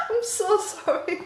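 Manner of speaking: sorry said through a high giggle; high laughing apology. Real, not acted.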